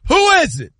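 sudden high-pitched demand, startled awake; alert sleeper challenge. Retold or imitated. Dad hearing a door open in his sleep, high alert challenge. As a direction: jolt up and call out high. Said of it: "If a door opens".

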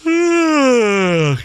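big fake yawn sigh. Mock tired yawn sigh.

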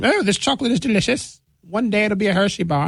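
high, delighted fake English; mock English accent. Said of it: "making the damn French sound like the damn English".

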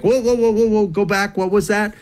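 high, urgent mock interjection; flustered interruption. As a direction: act out scrambling to catch up, pitched well up. Acting out frantic note-takers' interruption.

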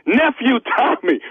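usual speaking voice

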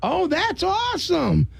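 high, drawn-out enthusiasm; real delight. Real, not acted. Host hearing about guest's wife, delighted. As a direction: gush slowly at the good news.

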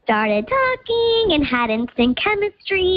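digitally disguised voice; pitch-shifted anonymous voice; voice changer. Real, not acted. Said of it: "'cause Voice Changer's on".

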